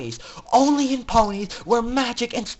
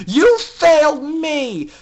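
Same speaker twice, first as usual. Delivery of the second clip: slow, louder, higher repeat; repeated verdict, slower and heavier; furious, slower; 8 semitones higher, 8 dB louder, slower than usual. Acted. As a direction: drag out the blame. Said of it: "remembering her anger".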